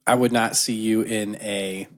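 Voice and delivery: normal talking voice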